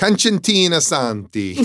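hammed-up Italian accent, pitched high and loud. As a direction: say the name like a proud Italian. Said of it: "in my Italian accent".

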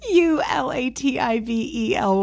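U L A T I V E L Y spelled letter by letter; spelling it out. Shown as a demonstration. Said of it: "I'm gonna spell it for you".

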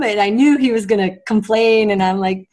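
usual speaking voice

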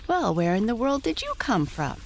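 high curious pony voice; talking pony. Acted. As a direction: ask like an eager young pony.

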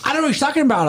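high-pitched feigned ignorance; mock innocent denial. Girl playing innocent to the doorman, high mock denial. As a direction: play dumb, pitched well up. Acting out girl denying fake ID.

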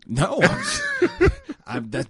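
high, loud, laughing denial; amused, loud self-defense; laughing protest. Real, not acted. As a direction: protest through real laughter at being teased. Teased, laughs while defending himself.

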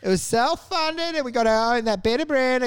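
high, proud self-funded retiree voice; mock smug retiree. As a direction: play the smug pensioner boasting. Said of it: "And you're like".

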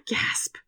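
a sharp, high, breathy mock gasp. Connor gasping at the crowd, mock shocked. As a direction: gasp in fake shock at the betrayal. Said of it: "looking at the crowd like".

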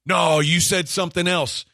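high, loud accusing snap; the other coach accusing him, loud and sharp; mock angry accusation. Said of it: "yelling back and forth".

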